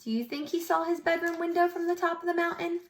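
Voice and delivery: ordinary delivery, nothing marked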